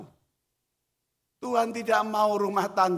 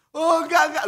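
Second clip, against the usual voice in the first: put-on frightened child refusing; 11 semitones higher, 8 dB louder, faster than usual. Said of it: "child refusing to jump".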